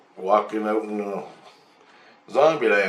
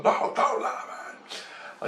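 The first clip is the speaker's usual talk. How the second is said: high, drawn-out mock yell, held back; soft stretched-out mock shout; retold yelling; 6 dB quieter, much slower than usual. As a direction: imitate a distant yell without volume. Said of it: "And he's yelling".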